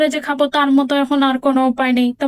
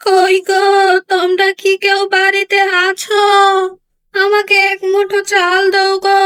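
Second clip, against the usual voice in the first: put-on higher, slow pleading call of an old beggar woman; 4 semitones higher, 3 dB louder, slower than usual. Old beggar woman calling to the house for rice. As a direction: call out at the door, begging for rice.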